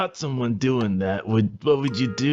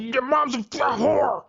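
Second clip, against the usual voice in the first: put-on drunken outburst; 13 semitones higher than usual. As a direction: yell it out of nowhere, drunk. Drunk man insults librarian.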